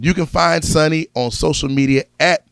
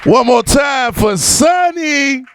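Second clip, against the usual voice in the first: high, drawn-out announcer call; host's applause call; 8 semitones higher, slower than usual. Real, not acted. Host to crowd after the comic's set, applause call. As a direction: call it out to the room, stretching the name.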